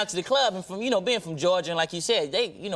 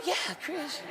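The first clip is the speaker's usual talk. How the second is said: high, soft hushed imitation; 9 semitones higher, 7 dB quieter than usual. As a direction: say it up high and quiet, gently. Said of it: "[name] would be covered up like".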